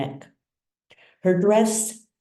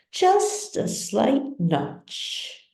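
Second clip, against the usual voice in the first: slow deliberate repeat; 6 semitones higher, much slower than usual. A narrator's reading.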